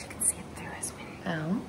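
soft hushed whisper. Real, not acted. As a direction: whisper it as a quiet aside. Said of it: "aside to listener".

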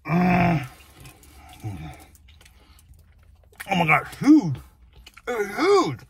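chewing while talking; speaking between big bites; mouth full of burger. Real, not acted. Seen 2: bites burger, cheeks working, talks between bites throughout.

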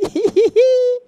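gleeful high-pitched cackle; excited worker laughing about the paid trip; high giddy laugh. Acted. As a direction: laugh like you got away with it.

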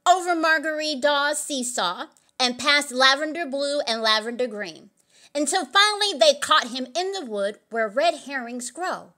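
ordinary delivery, nothing marked

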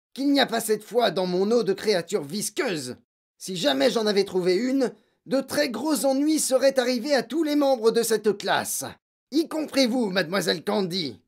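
loud, high-pitched threat to the class; overbearing, forceful warning; menacing headmistress. A narrator's reading.

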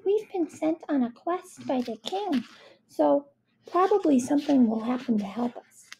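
high, untroubled character voice; chirpy optimism; cheerful. Acted. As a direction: explain it cheerily, starting well up high. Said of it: "she said cheerfully".

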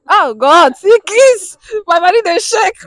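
loud, high squeal of delight; overjoyed excitement. Real, not acted. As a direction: burst out thrilled at the couple. Host thrilled watching couple kiss.

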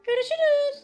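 put-on the kid's mother phoning the babysitter, high and quick; mother on the phone. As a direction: play the kid's mother calling in. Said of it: "annesi arıyor çocuğun".

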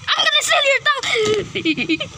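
high put-on villain menace; lightly voiced cartoon villain. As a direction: taunt them like a cartoon baddie. Villain threatens the others.

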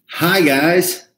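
show-host greeting. Pretend. Husband restarts the video as take two, loud mock-cheery greeting. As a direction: launch into a big, put-on hello.

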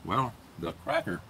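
speaking between bites; talking while chewing. Real, not acted. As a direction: mouth partly full of cracker. Seen 3: Just ate cracker; jaw working, lips pursed at +0.9–1.6.

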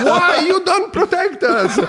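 high, drawn-out wailing plea; hammed-up indignant yell, close to laughing; retold shouting. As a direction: stretch the protest out in a slow, high cry. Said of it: "di me che grido".

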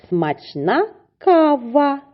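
put-on high, slow, drawn-out reading for children; slow sing-song reading. Shown as a demonstration. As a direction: read it slowly and brightly, like to first graders. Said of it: "teacher reading practice line".

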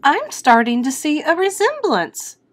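high-pitched mother character; mother's voice. A narrator's reading. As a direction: lift it into the mother's voice. Mother's line in the story.

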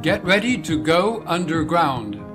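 normal talking voice

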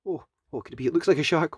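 ordinary delivery

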